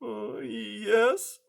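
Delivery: high, hesitant scared mouse; timid high little voice; frightened mouse. A narrator's reading. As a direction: stammer it out in fear. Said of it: "even more frightened".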